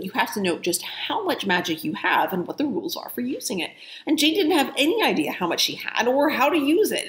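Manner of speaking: put-on reading a children's book aloud, lively and clear; story narration. A narrator's reading. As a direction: tell it like a bedtime chapter to listeners. Reads novel aloud.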